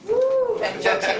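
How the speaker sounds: a high, loud woo of delight; excited whoop. Real, not acted. Club president cheering another Nepal guest, excited whoop. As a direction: cheer the newcomer like a fan.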